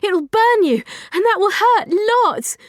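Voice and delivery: high, loud urgent pleading; urgent warning. Acted. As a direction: warn him, still shaken. Frankie warns Romeo about fire.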